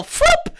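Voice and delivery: a sudden high, loud whoop; quick gleeful whoop. Pretend. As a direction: yelp it like a sound effect. Sound effect for snatching someone.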